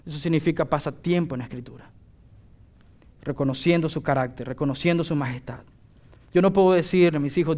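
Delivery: ordinary delivery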